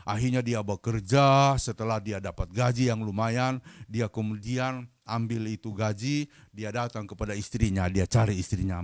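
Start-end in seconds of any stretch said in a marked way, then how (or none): none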